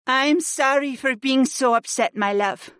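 high female character voice, upset; apologetic wife. Acted.

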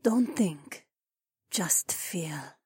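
high, soft breathy whisper. A narrator's reading. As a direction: coax him in a whisper. Lover whispering in sex scene.

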